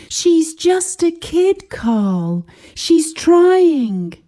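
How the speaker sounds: high, pleading mother; soft pleading. A narrator's reading. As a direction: plead gently for the kid. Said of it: "Her voice was soft, pleading".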